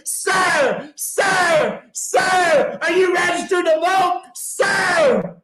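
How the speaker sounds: high mock yell, calling across a parking lot; mock shout from afar. Woman calling across the parking lot at Lowe's, high mock yell. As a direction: call out to him from far away, pushy and high.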